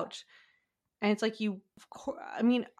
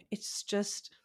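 whisper; 6 dB quieter than usual. Real, not acted. Whispered aside.

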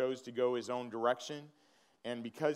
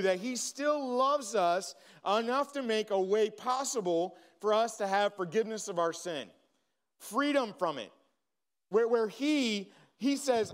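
preaching; 6 semitones higher than usual. Acted. Pastor preaching forgiveness to the congregation, fervent and raised. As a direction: preach it out, building with passion.

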